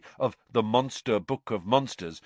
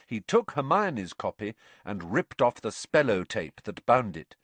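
usual speaking voice

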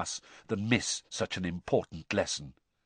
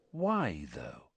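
small inner voice; 6 semitones lower, 6 dB quieter than usual. A narrator's reading. Boy's own mind doubting the command, softly puzzled. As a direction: quietly question the order. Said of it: "Another voice had awoken".

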